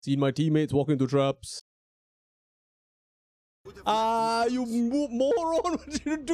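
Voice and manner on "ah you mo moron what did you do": high, frustrated outburst at teammate; exasperated yell. Real, not acted. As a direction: cry out in disbelief. Teammate walks into trap.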